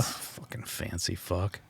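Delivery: low, slow muttered jab. Real, not acted. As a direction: grumble it under your breath. Mocking friend's fancy salad.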